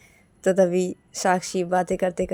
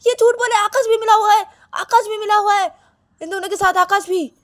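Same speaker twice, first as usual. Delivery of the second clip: very high, loud screaming accusation; shrieked quarrel, acted full out; angry shouting; 10 dB louder than usual. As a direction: shout in outraged betrayal. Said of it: "कैसे चिल्ला रही हो".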